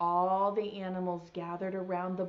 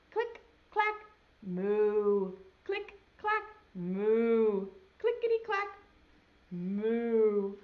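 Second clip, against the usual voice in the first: typing cows; 4 dB louder, slower than usual. Acted. Cows typing refrain again.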